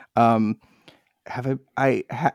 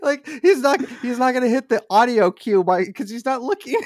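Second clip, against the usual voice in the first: excited; 11 semitones higher than usual. Retold or imitated. Mocking friend's inattention.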